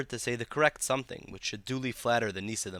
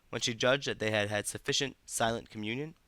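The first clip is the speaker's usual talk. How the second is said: ordinary delivery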